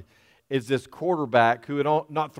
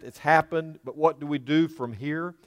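usual speaking voice

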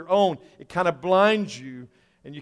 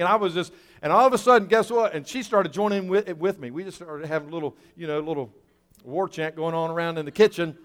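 ordinary talk

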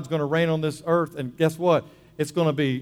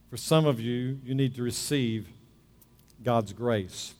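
lowered, softened preacher's invitation with long pauses; gentle altar call; 3 semitones lower, 4 dB quieter than usual. Real, not acted. Preacher to congregation with heads bowed, gentle altar call.

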